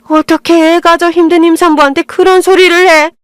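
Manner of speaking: a hint of a shrill in-law scolding; mother-in-law lecturing her son's wife, mildly indignant. Mother-in-law berates daughter-in-law.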